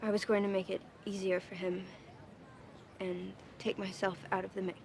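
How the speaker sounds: pained, low-key admission; halting confession. Acted. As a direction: get it out a few words at a time, reluctantly. Daughter painfully confesses letter's contents.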